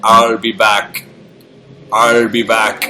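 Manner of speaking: a hint of a Terminator; Arnold Schwarzenegger impression. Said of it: "he says about ten lines and they're all so iconic".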